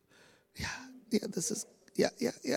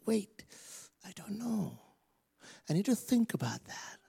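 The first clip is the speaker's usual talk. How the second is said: hesitant thinker; 6 semitones higher, 10 dB quieter than usual. Retold or imitated. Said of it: "village of thinkers, people who sit and wait".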